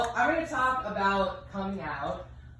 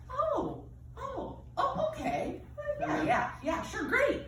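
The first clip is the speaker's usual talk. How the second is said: mildly surprised friend. Retold or imitated. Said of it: "a couple of them were like".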